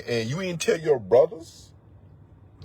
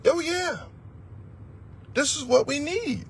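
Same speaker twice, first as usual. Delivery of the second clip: fired up; 12 semitones higher, 3 dB quieter than usual. Real, not acted. Preacher cheering his own point.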